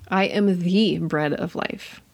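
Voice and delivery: slow, with "the" stretched to a long "thee"; stress on THE. Shown as a demonstration. Teacher showing how emphasis changes a verse, stressing "the". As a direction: lean hard on "the", said as "thee". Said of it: "emphasize one different word each time".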